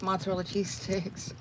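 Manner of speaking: ordinary talk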